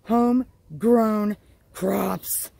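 ordinary delivery

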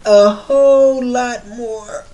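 slow, loud stretched sign-off; drawn out. Real, not acted. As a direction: stretch every word out big. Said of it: "stretched sign-off".

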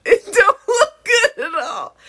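loud, high squeal of real laughter; words squeezed out through giggles; laughing. Real, not acted. Judging the blonde with the blue, laughing. Cracks up at the look.

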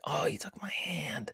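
soft whispered swoon; breathy whisper. Retold or imitated. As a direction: whisper it like a shy heroine. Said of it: "she's like".

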